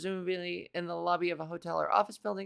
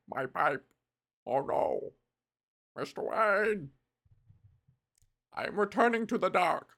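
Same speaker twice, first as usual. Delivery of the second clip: hammed-up villain fading into the dark; dying villain; 5 semitones lower than usual. Villain blaming Mister Wayne, hammed-up dying lament. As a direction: mourn the pipe, lower and dramatic.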